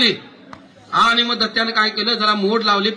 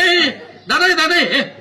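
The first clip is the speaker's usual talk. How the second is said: high call across the house; 6 semitones higher, much slower than usual. A narrator's reading. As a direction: call out for the wife. King calling out to wife. (बायकोला हाक)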